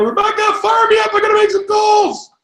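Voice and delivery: high shouted hype; pumped-up plea. Pretend. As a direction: call a friend to get fired up. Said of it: "Call Rebecca".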